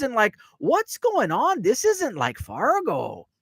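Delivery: put-on high, sing-song Fargo accent with stretched vowels. Fargo bartender tells visitors of her Chicago trip, in an exaggerated Fargo accent. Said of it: "That's exactly how they talk".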